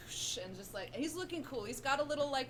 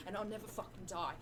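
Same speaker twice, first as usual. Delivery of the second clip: normal talking voice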